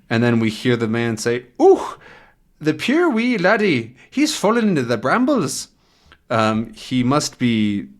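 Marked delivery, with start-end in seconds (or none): 1.6-5.7 high-pitched put-on Scottish or Irish accent; thick Scottish accent. Shepherd pitying fallen boy, put-on Scottish accent. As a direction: exclaim pityingly in imitated Scottish. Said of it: "he has an accent, a thick accent".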